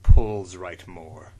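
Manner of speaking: low, slow, loud deadpan; hammed-up dramatic reading; mock solemn. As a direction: savour each misspelt word slowly. Mocking the badly spelled review.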